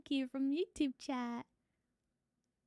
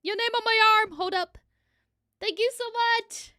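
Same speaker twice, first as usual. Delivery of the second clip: excited; 8 semitones higher, 8 dB louder than usual. Real, not acted. Excited thanks for donation.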